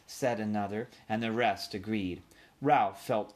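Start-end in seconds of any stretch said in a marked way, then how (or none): none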